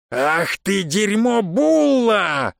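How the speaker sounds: high, slow, drawn-out bellow; furious roar. A narrator's reading. Бонги insulting the man who provoked him, roaring. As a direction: roar the insult, stretching the last word. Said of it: "взревел Бонги".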